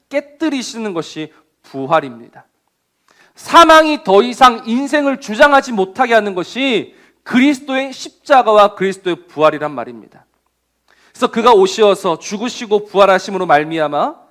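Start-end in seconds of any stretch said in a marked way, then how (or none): none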